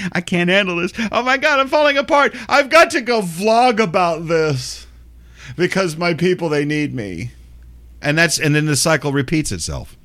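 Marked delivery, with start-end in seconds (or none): 0.1-7.3 high hammed-up panic; mock meltdown. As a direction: overplay a dramatic breakdown. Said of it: "mocking influencer breakdown".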